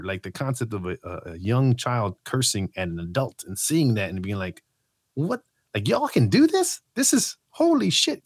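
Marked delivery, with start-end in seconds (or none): 5.1-8.2 high, stunned squeal of disbelief; shocked disbelief. Retold or imitated. Kid at friend's house, shocked by cursing, squealing. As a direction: act out a kid's jaw-dropping shock.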